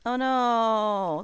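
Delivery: usual speaking voice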